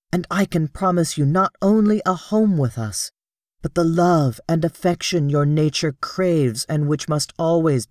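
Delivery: high, earnest persuasion; pleading princess. Acted. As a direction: win Sola over.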